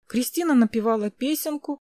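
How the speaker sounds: usual speaking voice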